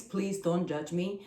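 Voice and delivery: ordinary talk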